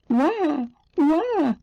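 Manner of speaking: high storybook wail; mock crying. A narrator's reading. Narrator as the frightened little girl, high mock cry. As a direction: imitate a little girl's wah. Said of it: "she cried".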